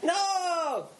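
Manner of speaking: high, drawn-out cry of no. Real, not acted. Player protesting the game master's ruling, high drawn-out cry. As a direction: wail it out in protest, high and long.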